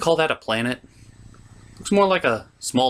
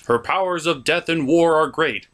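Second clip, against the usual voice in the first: ordinary talk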